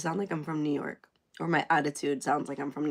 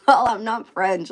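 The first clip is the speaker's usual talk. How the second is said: mock protest; 4 semitones higher, 11 dB louder than usual. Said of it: "I'm like".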